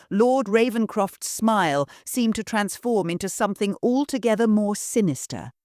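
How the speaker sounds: plain reading voice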